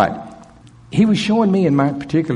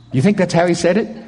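normal talking voice

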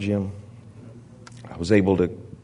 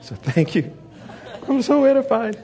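laughing; 14 semitones higher than usual. Real, not acted. Mocking thanks for bigger devil.

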